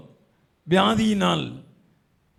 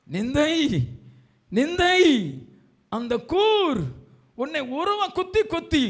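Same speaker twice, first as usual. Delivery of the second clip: high, strained cries, word by word; anguished preaching; 11 semitones higher than usual. Real, not acted. Preacher describes shame stabbing the listener, anguished. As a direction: push the pitch up in anguish.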